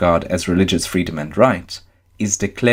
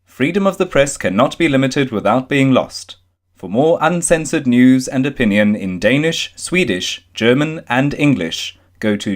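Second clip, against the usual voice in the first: higher, bright announcer promo; promo read; 4 semitones higher than usual. Acted. Reading the publication promo to listeners, announcer read. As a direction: sell the publication like a station ID. Promo bumper before the article.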